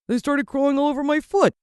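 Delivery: a dad fed up with ants on his foot; annoyed dad. Acted.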